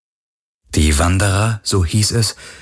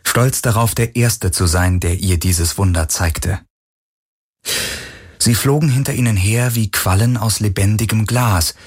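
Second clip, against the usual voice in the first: ordinary delivery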